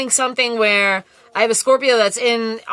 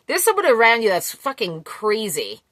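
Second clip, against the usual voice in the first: ordinary delivery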